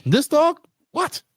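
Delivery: high, incredulous what; high mock astonishment at the dog allowed indoors; mock disbelief. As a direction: act shocked that the dog is inside.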